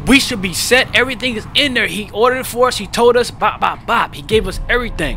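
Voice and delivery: loud, high, frantic pleading; panicked protest. Retold or imitated. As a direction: insist in a rising panic that it was ordered. Panicked arguing at rental desk.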